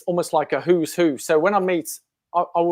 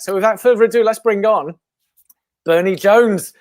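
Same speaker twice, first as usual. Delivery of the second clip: big booming build-up to the guest name, louder and higher; announcer intro; 5 semitones higher, 9 dB louder than usual. Acted. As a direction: announce the guest like a show host. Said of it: "Did you like that intro?".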